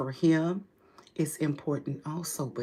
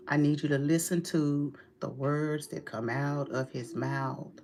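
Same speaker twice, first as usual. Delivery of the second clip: ordinary delivery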